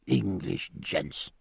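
slow, soft, fawning greeting; butter up the English, drawn out; a hint of an oily leer; ingratiating leer. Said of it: "with an ingratiating leer".

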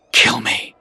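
lightly gasped plea. A narrator's reading. Wounded Jedi taunts his attacker, gasping. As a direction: say it with a touch of breathlessness. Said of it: "gasped the Jedi".

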